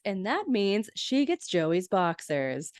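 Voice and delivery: ordinary delivery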